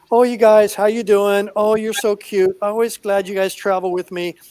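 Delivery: put-on high, loud cooing baby talk to her pets. Owner cooing down her blouse at her sugar gliders, high baby talk. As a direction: gush over little pets like babies.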